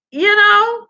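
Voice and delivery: squeaky high "you know?" rising; high squeal. Real, not acted. Asking the listener to imagine, squealed high. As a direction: squeal it out in disbelief.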